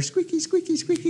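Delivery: high, squeaky little sing-out; squeaky wheel imitation. Dad mimics daughter's little bike, high squeak.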